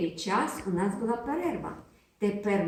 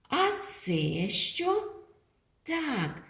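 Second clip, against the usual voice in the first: usual speaking voice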